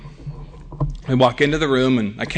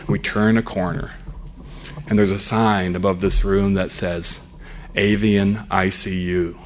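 low, hushed, grave narration; slow the scene down into dread; solemn suspense storytelling; 8 semitones lower, 4 dB quieter than usual. Acted.

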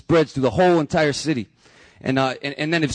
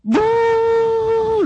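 very high, louder mock bull bellow; 4 dB louder than usual. The bull right behind the narrator lets out a bellow, mock roar. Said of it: "lets out this bellow".

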